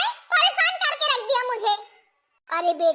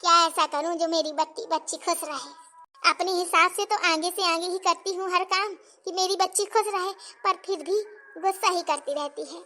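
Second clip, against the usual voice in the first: low, soft motherly lament; subdued, troubled soliloquy, pitched low; worried musing; 6 semitones lower, 5 dB quieter than usual. Acted. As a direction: wonder sadly to yourself about your daughter. Worried mother muses alone about daughter.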